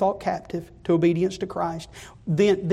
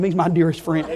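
ordinary delivery

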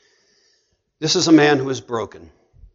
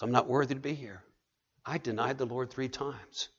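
ashamed inner voice; 14 dB quieter than usual. Retold or imitated.

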